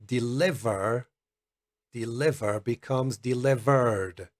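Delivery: delivered ending in a clear voiced d. Shown as a demonstration. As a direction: say delivered with a buzzing d at the end. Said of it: "it sounds like a D for delta".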